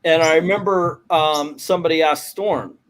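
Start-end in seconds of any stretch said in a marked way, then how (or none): none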